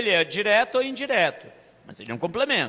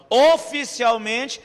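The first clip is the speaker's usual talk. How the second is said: "oficialmente" slow and louder, rising at the end; stress on oficialmente; 3 semitones higher, 5 dB louder, slower than usual. Shown as a demonstration. Teacher to class, stressing "oficialmente" slowly and loudly. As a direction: lean on "oficialmente". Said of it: "O que é que significa oficialmente?".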